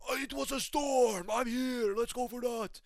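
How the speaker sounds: high, hyped fighter voice, hammed up; giddy fighter impression. Yuri mid-fight, a high, hammed-up hype. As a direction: crank it up like a wild brawler.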